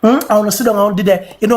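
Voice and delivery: normal talking voice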